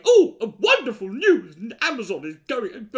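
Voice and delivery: mock newsreader gush. Mocking the news as a YouTube ranter imagines it, gushing. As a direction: ham up a thrilled presenter. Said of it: "they weren't there going".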